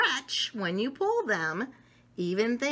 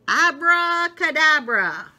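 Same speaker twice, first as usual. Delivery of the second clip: magic spell; 5 semitones higher, 9 dB louder than usual. Pretend. Child tries a magic spell.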